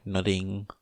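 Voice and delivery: ordinary delivery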